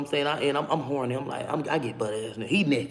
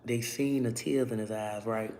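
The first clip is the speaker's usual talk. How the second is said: whisper; 7 semitones lower, 9 dB quieter, slower than usual. Real, not acted. Whispered aside.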